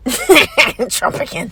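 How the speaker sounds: chuckling over the word; laughing. Real, not acted. Amused at own coined word.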